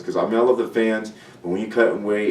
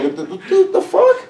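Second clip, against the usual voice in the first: laughing; 4 dB louder, slower than usual. Real, not acted. Laughing at the memory.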